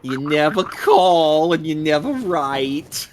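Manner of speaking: nagging mother voice. Pretend. Mock nagging mom message.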